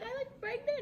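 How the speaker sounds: very high, delighted squeak; happy disbelief. Retold or imitated. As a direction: squeak it, thrilled. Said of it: "I got all happy".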